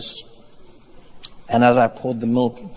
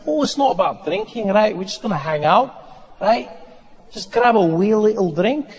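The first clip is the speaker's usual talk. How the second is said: put-on higher, lilting Scottish accent; coaxing Scottish friend; 6 semitones higher than usual. Scottish friend talking comic into a drink, coaxing lilt. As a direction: coax lightly in Scottish, making it sound harmless. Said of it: "Scottish people do that so well".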